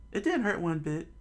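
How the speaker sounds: put-on high boyish boast; kid bragging. Luffy boasts to Shanks, high boyish voice. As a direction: brag like a proud kid.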